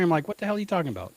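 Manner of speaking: ordinary talk, nothing marked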